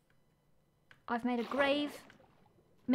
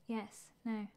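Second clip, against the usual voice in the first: ordinary talk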